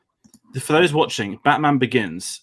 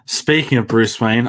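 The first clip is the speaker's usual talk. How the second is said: usual speaking voice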